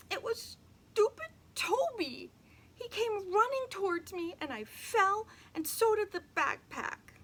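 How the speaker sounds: put-on high, loud, near-crying girl; a little girl, crying and upset; tearful girl. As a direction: blame Toby through tears. Emily near tears.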